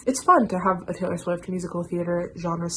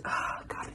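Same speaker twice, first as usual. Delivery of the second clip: exasperated sigh; 11 dB quieter than usual. Real, not acted.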